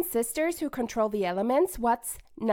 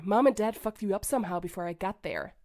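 normal talking voice

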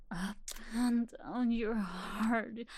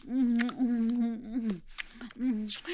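humming moans; slower than usual. Acted. Moaning during roleplay.

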